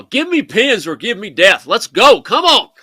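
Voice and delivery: loud, high rallying cries; excited cheering. Real, not acted. Commentator rooting for Gukesh over the board, excited cheering. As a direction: urge the player on at full voice.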